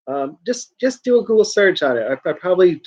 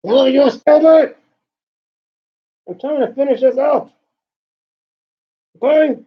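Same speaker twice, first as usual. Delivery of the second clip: exasperated scolding; 7 semitones higher, 5 dB louder than usual. Real, not acted. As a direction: tell the pestering cat to quit it. Scolding the cat interrupting him.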